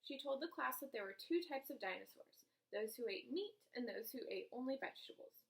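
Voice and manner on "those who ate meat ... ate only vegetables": usual speaking voice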